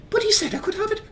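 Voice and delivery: put-on very high pleading little-boy voice; Geordie Thomas. Son pleading to keep his coin, high child voice. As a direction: protest in a tiny high pipe. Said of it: "as little Geordie Thomas".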